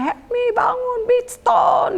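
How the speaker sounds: very high, drawn-out wake-up call; imitated yell. Hostess wakes lodger for devotions, high imitated yell. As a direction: mimic a shrieking hostess rousing the house. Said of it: "pagi-paginya diteriakin".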